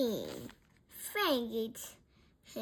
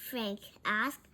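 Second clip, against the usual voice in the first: ordinary delivery, nothing marked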